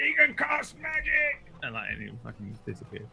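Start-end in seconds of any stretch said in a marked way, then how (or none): 0.1-1.5 very high, slow panicked cry; panicked guard. Acted. Fleeing guard cries out down the echoing chamber, high. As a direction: shriek it as the guard flees. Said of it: "he's like".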